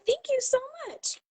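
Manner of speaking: high, delighted imitation of thanks; gushing thanks. Store worker thanking the seller for earrings, imitated high and gushing. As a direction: imitate someone gushing over a gift.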